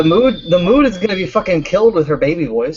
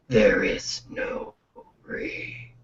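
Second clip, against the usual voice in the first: unvoiced fading whisper; whispered fade; 7 semitones lower, 8 dB quieter, much slower than usual. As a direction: let the words die into a whisper. Death fades out.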